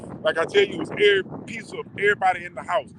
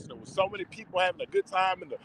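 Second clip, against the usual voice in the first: ordinary delivery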